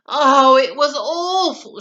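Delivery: tired princess complaining dramatically; sighing. A narrator's reading. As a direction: moan it out like a sleepless night. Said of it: "she sighed".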